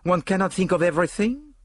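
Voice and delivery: put-on high, nonchalant French-accented voice; breezy self-excusing French detective; Poirot, airy. A narrator's reading. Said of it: "said Poirot airily, his nonchalance quite restored".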